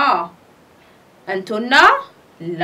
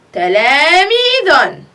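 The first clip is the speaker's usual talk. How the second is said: تلاميذ drawn out very slowly and louder; slow, loud word; 5 dB louder, much slower than usual. Shown as a demonstration. Teacher repeating the word for pupils, slow and loud. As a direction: sound out تلاميذ slowly and clearly. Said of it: "نقاط تلاميذ".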